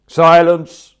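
very loud, high command; officious bellow. Acted. Court official silencing the protesting prisoner, loud officious bellow. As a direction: roar for order in court. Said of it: "bellowed the officious blighter".

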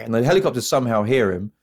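normal talking voice

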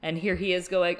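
ordinary talk, nothing marked

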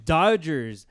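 loud hype announcer call; fighting game announcer. Acted. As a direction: boom it out like a versus screen. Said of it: "We're gonna have to maybe re-record this part again".

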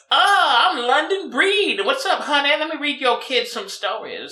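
high, slow sassy drag queen. Pretend. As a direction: camp it up high and sweet. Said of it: "sounds like a drag queen handle".

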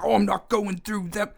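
high-pitched attempt at Australian; botched Australian accent. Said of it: "I can't do Australian".

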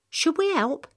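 put-on a curious young girl asks, lifted and bright. Samantha pleads.

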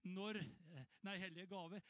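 ordinary delivery

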